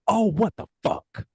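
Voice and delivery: high, slow shocked outburst; wrestling promo. Retold or imitated. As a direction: blurt it out in disbelief. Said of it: "waiting for somebody to go".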